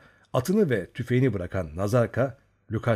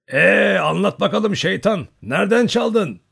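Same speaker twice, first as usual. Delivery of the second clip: put-on higher, louder teasing old man's shout; a hint of an old man bellowing to the thief; old uncle shouting his question at the young Cossack; 9 semitones higher, 7 dB louder than usual. A narrator's reading. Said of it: "diye bağırdı".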